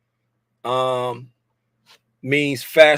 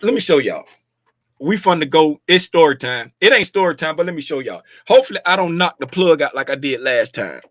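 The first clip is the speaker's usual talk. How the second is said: ordinary talk, nothing marked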